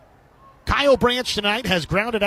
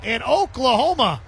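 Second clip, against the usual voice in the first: rising, shouted play-by-play as the ball leaves; excited home run call; 7 semitones higher than usual. Real, not acted. Announcer calling a home run to listeners, shouting. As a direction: belt it out as it clears the fence.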